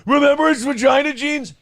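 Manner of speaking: high, eager, gleeful fan; obsessed fan voice. Retold or imitated. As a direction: gush it like a superfan. Voicing an obsessed subreddit fan.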